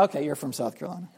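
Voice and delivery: quick, low mock acknowledgement; imitating someone now convinced; conceding recognition. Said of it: "You go".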